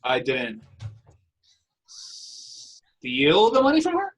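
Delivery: high and loud, money rises sharply; disbelieving question on money; incredulous rise on money. Shown as a demonstration. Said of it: "we're gonna say it with a particular intonation".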